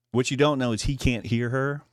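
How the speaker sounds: ordinary talk, nothing marked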